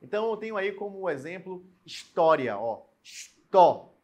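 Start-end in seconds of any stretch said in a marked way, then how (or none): 1.8-2.8 "história" with a strong, open Ó in the middle syllable; stress on the Ó. Shown as a demonstration. Teacher showing students where the accent falls, stress on the Ó of "história". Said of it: "O acento ali no O". 3.0-3.9 "estó" drawn out slowly, loud on the final Ó; stressed syllable demo. Shown as a demonstration. Teacher isolating the accented syllable for students, heavy stress on "estó". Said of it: "O acento ali no O".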